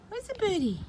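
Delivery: usual speaking voice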